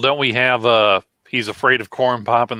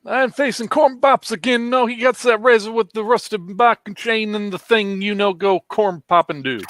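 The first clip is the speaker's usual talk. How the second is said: high squeaky voice; 10 semitones higher than usual. Retold or imitated. Mimics Biden, pitch way up.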